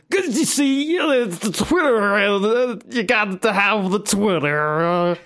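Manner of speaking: high, slow Cosby voice; Cosby impression. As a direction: do a goofy Bill Cosby. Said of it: "I'm still hearing Cosby".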